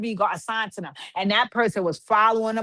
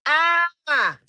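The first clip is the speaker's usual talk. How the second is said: excited scream; 6 semitones higher, 4 dB louder than usual. Real, not acted.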